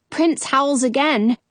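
ordinary delivery, nothing marked

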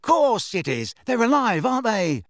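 irritated, high-pitched comeback; angry. A narrator's reading. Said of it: "said Fred angrily".

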